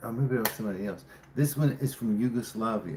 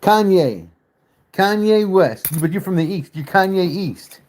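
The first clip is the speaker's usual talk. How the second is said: loud and high, a gleeful punchline; excited joking; 8 semitones higher, 9 dB louder than usual. Real, not acted. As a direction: shout out the joke name with delight. Excited joke name guess.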